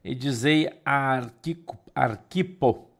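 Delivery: firm, projected Bible reading; measured, declamatory reading with weighty pauses; scripture reading. Acted. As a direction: read the epistle out to the congregation, phrase by phrase. Reads the Bible passage aloud.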